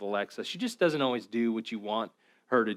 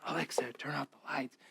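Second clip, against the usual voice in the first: sneaky whispered reply; 11 dB quieter than usual. Retold or imitated. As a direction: hiss it right back at the device. Prankster keeps going, whispering.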